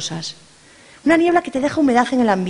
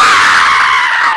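low, loud unvoiced shriek; group scream; 8 dB louder than usual. Friends scream at the stolen shroud, loud scream. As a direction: scream in horror. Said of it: "Gritaron todas".

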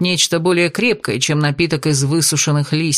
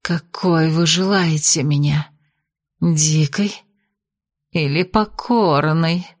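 медленно, мурлыкающе и ниже; соблазнительно промурлыкать вопрос; мурлыкая; 2 semitones lower, slower than usual. Acted. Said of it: "промурлыкала ведьма". (ведьма мурлычет бесу во сне)

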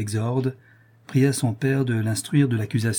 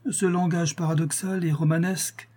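higher, scornful irritation; irritated; 7 semitones higher than usual. A narrator's reading. Irritated marquis scorns his son, higher and sneering. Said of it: "reprit le marquis irrité".

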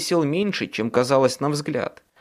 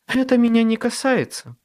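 dismissive fairy voice; 9 semitones higher than usual. Acted. Faerie godmother dismissive.

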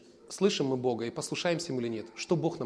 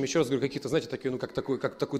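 ordinary delivery

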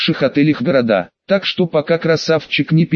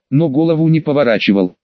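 usual speaking voice

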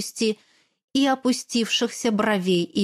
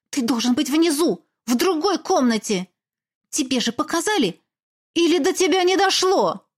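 angry scolding; 5 semitones higher than usual. Acted. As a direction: tell him off, getting angrier. Heroine scolds the incubus.

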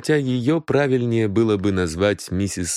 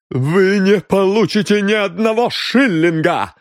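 high and loud; furious refusal; 11 semitones higher, 5 dB louder than usual. A narrator's reading. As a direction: deny him every penny. Refuses the reward. (яростный отказ платить награду)

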